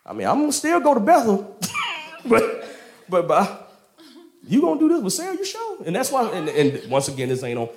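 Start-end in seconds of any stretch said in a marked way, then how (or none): 0.0-5.8 high, halting comic protest; mock doubt. Abram questioning God's promise about Sarah, high doubtful protest. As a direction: question it hesitantly in a raised voice, half laughing.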